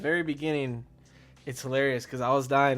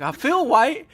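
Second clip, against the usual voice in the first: much higher and louder, hammed-up protest; mock panic; 12 semitones higher, 9 dB louder, slower than usual. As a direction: insist it in high mock panic. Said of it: "he starts freaking out".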